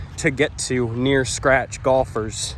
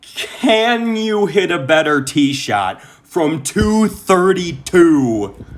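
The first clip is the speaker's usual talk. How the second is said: higher, slow, marvelling; amazed; 6 semitones higher, 4 dB louder, much slower than usual. Real, not acted. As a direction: stretch out two thirty-two in awe. Marvelling at own tee shot.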